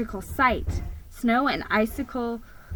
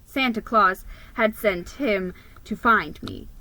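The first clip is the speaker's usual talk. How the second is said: plain reading voice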